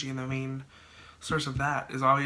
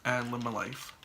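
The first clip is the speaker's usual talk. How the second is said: stuffy, blocked nose; talking through a head cold; congested. Real, not acted. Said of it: "Sorry if I sound really congested".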